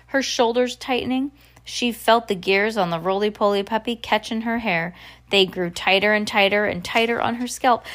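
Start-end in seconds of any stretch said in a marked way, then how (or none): none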